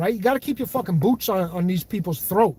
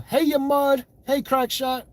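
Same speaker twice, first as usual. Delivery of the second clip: lightly put-on voice, pitched higher; imitated voice; 5 semitones higher, slower than usual. Greeting chat viewers by name, in a lightly imitated voice. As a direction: have a go at someone else's voice. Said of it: "Notice how I'm changing my voice".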